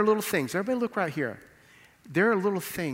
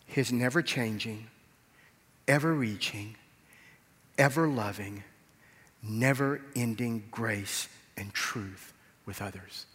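ordinary talk, nothing marked

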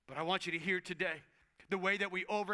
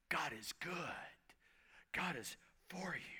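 hushed voiceless reassurance; whisper; 3 semitones lower, 8 dB quieter than usual. Real, not acted. Preacher comforting the congregation, voiceless whisper. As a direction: whisper it close and tender.

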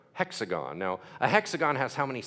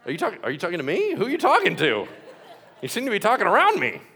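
high, louder, incredulous questioning, played for laughs; mock baffled Lord; 5 semitones higher, 6 dB louder, faster than usual. Preacher playing the Lord puzzled by a frantic prayer, mock baffled. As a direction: act comically put out at being talked past. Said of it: "Lord's like".

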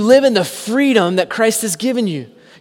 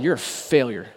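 softer, low accusing murmur; mock enemy whisper; 6 semitones lower, 7 dB quieter than usual. The enemy's voice accusing the listener, softly murmured. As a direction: hiss of the accuser, hushed. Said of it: "you'll hear whispers of like".

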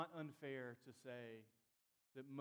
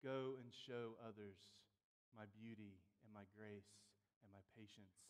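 tender, hushed; 4 semitones lower than usual. Acted. God to his beloved Israel and church, tender and hushed. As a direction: speak it gently, with long pauses.

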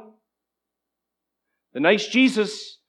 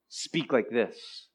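murmuring; 8 semitones lower, 10 dB quieter, much slower than usual. Retold or imitated. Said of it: "Murmuring in their hearts".

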